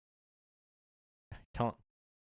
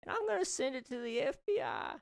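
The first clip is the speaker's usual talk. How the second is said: a granny laying down the law with a countdown; scolding old lady; 8 semitones higher than usual. Acted. Old lady scolds chat, threatens ban.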